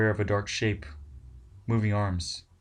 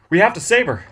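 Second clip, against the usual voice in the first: high and loud, urgent; Ted leaping up, pleading urgently; urgent plea; 10 semitones higher, 12 dB louder than usual. Acted. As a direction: blurt it, desperate to act.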